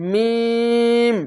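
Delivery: লাম held long and slow; drawn-out letter name. Shown as a demonstration. Teacher showing students the long letter, drawn out. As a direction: stretch লাম three alif. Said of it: "এখানেও তিন আলিফ টেনে পড়বেন".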